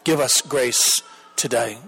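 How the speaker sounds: ordinary talk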